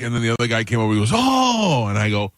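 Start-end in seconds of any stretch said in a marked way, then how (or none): 1.1-1.9 high, drawn-out oh of awe; hammed-up high gasp of wonder; mock amazement. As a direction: imitate an onlooker's astonishment. Second dealer amazed at the tiles.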